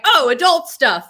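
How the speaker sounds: mock realization. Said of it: "we're like".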